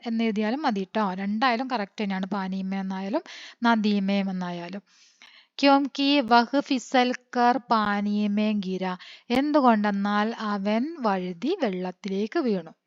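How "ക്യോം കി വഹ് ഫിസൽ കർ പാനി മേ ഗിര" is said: slow, careful word-by-word reading; slow dictation. Shown as a demonstration. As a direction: dictate it so the class can copy. Dictating the Hindi answer. (പതുക്കെ dictation ശൈലിയിൽ വായന)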